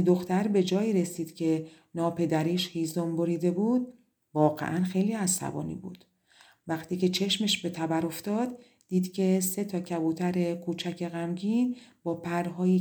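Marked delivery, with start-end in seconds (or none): none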